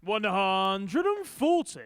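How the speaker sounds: long drawn-out score call; darts caller. Acted. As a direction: stretch the call out, booming.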